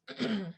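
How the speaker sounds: harsh throat clear. Real, not acted. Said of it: "I'm clearing my throat a lot".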